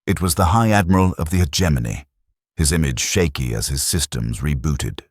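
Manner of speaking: ordinary delivery, nothing marked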